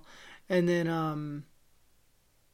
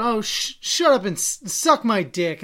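mock angry yell, raised pitch; retold yell; 4 semitones higher, 6 dB louder than usual. Imitating a bus passenger lashing out over a seat, mock yell. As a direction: imitate a woman snapping back loudly. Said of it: "she just yelled".

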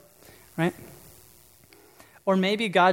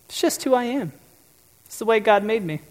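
higher, mock self-satisfied voice; smug excuse; 4 semitones higher, 4 dB louder than usual. Preacher mocking a congregant's excuse, higher mock voice. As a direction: lightly parody someone excusing their sin. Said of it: "Or you might say".

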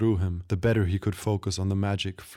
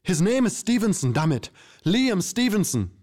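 much higher, frantic and pleading; panicked; 11 semitones higher, 3 dB louder than usual. A narrator's reading. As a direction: blurt it out in terror. Terrified captive blurts name.

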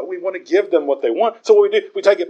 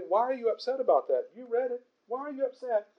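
gentle question; 7 semitones higher, 10 dB quieter than usual. Retold or imitated. Calm answer to angry person.

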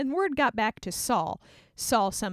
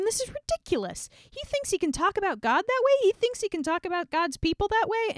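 indignant; 10 semitones higher than usual. Retold or imitated. David outraged at the taunt.

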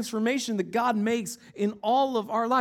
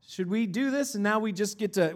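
usual speaking voice

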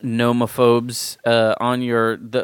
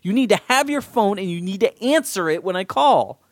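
high, urgent, insistent pleading; 9 semitones higher than usual. Retold or imitated. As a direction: beg her to answer the phone. Pleading with wife about phone.

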